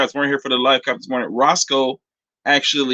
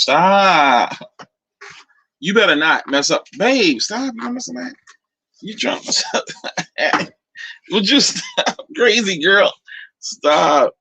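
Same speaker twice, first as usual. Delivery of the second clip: playful protest; 7 semitones higher, slower than usual. Real, not acted. Playfully fending off partner's touch.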